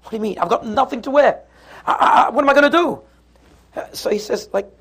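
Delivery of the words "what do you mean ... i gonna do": mounting panic, higher and quicker at the end; exasperated wife. Acted. Wife with nothing to wear for the wedding, rising exasperation. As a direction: work up into a stammering, high-pitched complaint. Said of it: "And she says".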